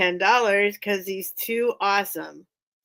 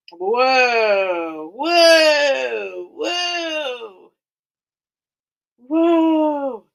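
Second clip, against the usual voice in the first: rising, louder whoas of surprise; amazed whoas; 4 semitones higher, 6 dB louder, much slower than usual. Real, not acted. Seeing the search results pop up, loud rising amazed whoas. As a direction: let each whoa climb in astonishment.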